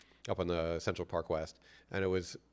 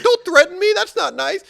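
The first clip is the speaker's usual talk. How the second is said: mock hurt innocence; 15 dB louder than usual. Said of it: "I was like".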